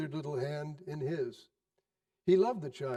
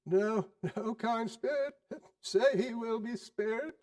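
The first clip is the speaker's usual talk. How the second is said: higher, pleading with the ghost; pleading Scrooge; 7 semitones higher than usual. Acted. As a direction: beg the spirit to spare the boy.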